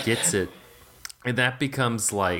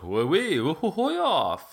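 hammed-up mock French accent, higher and squealing; 8 semitones higher than usual. The Chevalier character's entrance, mock French accent. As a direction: toss off silly French noises in a fake French accent. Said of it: "like, French accent".

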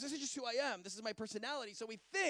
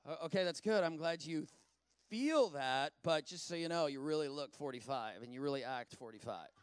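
unspoken sarcastic thought; 6 semitones lower than usual. Retold or imitated. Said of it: "I didn't say it out loud, but it was like".

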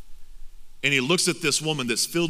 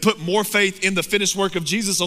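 rising passionate preaching; 4 semitones higher than usual. Acted. Preacher urging the congregation to quit their sin, building to full-force preaching. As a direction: drive the altar call home with rising passion.

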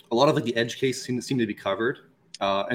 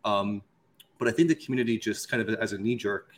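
normal talking voice

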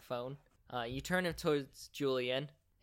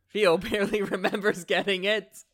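laughing; 6 semitones higher, 9 dB louder than usual. Real, not acted. GM laughing mid-narration.

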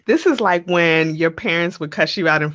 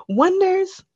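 high call to listeners; 13 semitones higher than usual. Real, not acted. Calling out to listeners.

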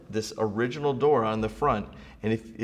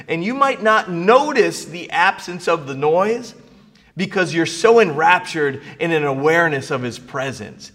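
loud sermon swell; rising pulpit fervour; preaching; 5 semitones higher, 7 dB louder than usual. Acted. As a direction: lift the congregation with it. Preaching peak on presence.

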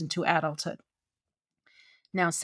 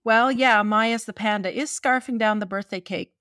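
ordinary talk

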